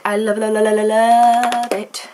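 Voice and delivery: over-the-top excited gushing; gushing adoration. Real, not acted. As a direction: gush over it, stretching out the last love. Gushing over the pink livery.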